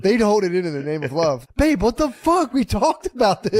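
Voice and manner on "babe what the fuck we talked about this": hammed-up boyfriend scolding; mock exasperated boyfriend. Boyfriend scolding girlfriend over her toilet habits, mock exasperated.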